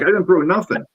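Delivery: high-pitched indignant denial, retold; mock-defensive, pitch jumping up; retold protest. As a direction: act out protesting innocence. Retold quarrel over a thrown object.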